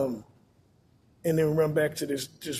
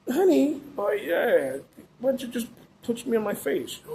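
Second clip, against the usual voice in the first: mocking the girlfriend; 7 semitones higher than usual. Girlfriend taunting her man in the car, mocking voice. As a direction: imitate her baiting him in the car. Imitating girlfriend's fake provocation.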